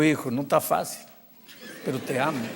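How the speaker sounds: hushed, low fatherly reassurance; tender sincerity. Retold or imitated. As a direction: say it softly, from the heart. Pastor repite su amor al hijo. (tierno y sincero, en voz baja)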